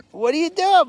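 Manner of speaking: high, loud pet talk. Real, not acted. Owner questioning her misbehaving pet, high pet voice.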